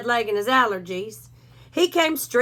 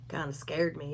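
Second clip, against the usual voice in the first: ordinary delivery, nothing marked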